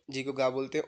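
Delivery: normal talking voice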